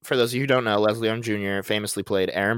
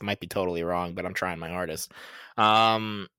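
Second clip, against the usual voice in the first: ordinary delivery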